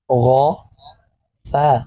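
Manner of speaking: each sound stretched very slowly and joined. Shown as a demonstration. Teacher sounding out a word for pupils, very slow and stretched. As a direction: draw the syllables out slowly, joining sound to sound. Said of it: "اگلے لفظ پہ آئیں گے تو".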